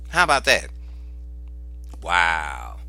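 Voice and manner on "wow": low, soft drawn-out wow; hushed astonished wow; stunned wow. Real, not acted. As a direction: let the card sink in, amazed. Reacting to a tarot card.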